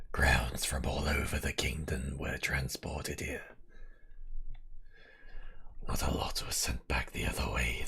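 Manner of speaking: low, slow, growling whisper; werewolf growl. Acted. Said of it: "I'll do the werewolf growl".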